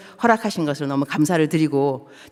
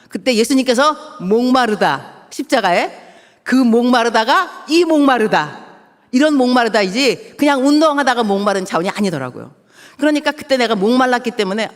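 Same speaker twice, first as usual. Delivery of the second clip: fervent preaching; 4 semitones higher, 4 dB louder than usual. Acted. Preaching on Christ's thirst.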